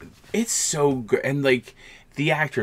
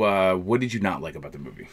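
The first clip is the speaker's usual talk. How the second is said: ordinary talk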